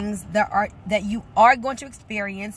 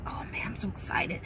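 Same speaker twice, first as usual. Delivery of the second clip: hushed excitement; 3 semitones lower, 8 dB quieter than usual. Retold or imitated. Excited recruit voiced.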